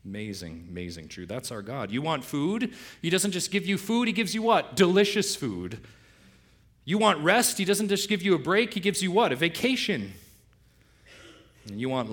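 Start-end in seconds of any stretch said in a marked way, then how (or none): 1.9-5.8 high, loud, building call and answer; rising excitement. Real, not acted. Preacher building rhetorical call. 6.9-10.1 high, loud, building call and answer; rising excitement. Real, not acted. Preacher building rhetorical call.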